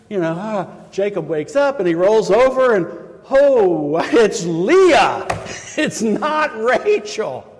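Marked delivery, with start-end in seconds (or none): 3.3-7.5 high, loud, dismayed gasp; shocked discovery. Acted. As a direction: wake up to the wrong bride. Jacob's morning shock, voiced.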